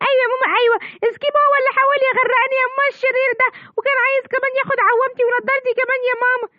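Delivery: put-on high child voice complaining; a rescued boy tells his mother who tried to drown him; whiny little boy. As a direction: tattle to mom like a small kid.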